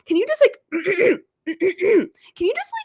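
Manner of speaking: put-on high kid voice with demonstrative throat-clears; imitated helpful little boy. Parent quotes son telling fisherman to clear throat, high child voice. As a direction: mimic a child coaching an adult, high and halting. Mimics small son advising a stranger.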